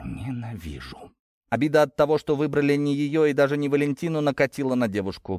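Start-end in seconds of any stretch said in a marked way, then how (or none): 0.0-1.1 slow, very soft and hateful; angry hiss. A narrator's reading. Jilted girl about the man, slow hushed hatred. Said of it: "прошипела девушка".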